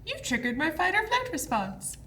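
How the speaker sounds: high put-on announcing voice; mock alarm. Podcaster quotes a joke about fighting back, mock alarm.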